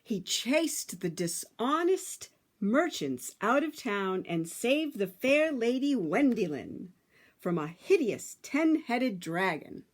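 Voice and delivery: ordinary delivery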